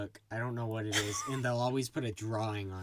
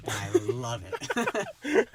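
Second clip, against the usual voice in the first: high, slow, loud gush; gushing love for a friend's cat; 7 dB louder, much slower than usual. Real, not acted. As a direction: melt over the cat.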